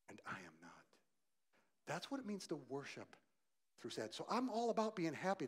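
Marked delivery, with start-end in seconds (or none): 0.1-0.7 hushed prayer. Pretend. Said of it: "sit in that sadness and go".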